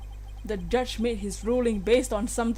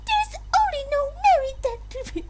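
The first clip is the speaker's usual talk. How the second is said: very high haughty princess voice; evil Peach voice. Acted. Streamer voicing the princess's ruling in an evil high voice. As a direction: sneer it out high as evil royalty. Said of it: "Evil Peach".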